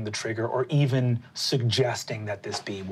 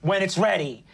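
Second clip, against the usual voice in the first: fed-up mom; 9 semitones higher than usual. Acted. Mother leaving the house, telling her sons off, high and huffy.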